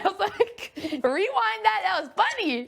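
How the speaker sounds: high, slow, laughing quote. Retold or imitated. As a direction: laugh through the line. Quoting herself, laughing.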